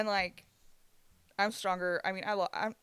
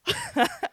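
1 laugh; 8 dB louder than usual. Real, not acted. Laugh after ranting line.

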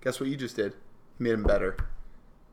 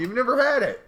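high teasing jab; 11 semitones higher, 5 dB louder than usual. Real, not acted. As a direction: pitch jumps up, teasing.

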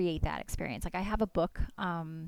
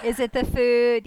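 louder, higher and slower, delighted repeat; enthusiastic echo; 5 semitones higher, 12 dB louder than usual. Real, not acted. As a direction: seize on the idea happily and stretch it out. Echoes co-host with enthusiasm.

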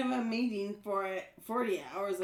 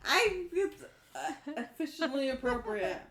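strained laughing; words squeezed through laughter; 3 semitones higher than usual. Real, not acted. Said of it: "You sound really like you're in pain".

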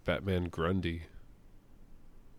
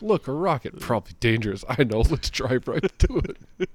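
mocking dumb hero; 4 semitones higher, 6 dB louder than usual. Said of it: "It's like".

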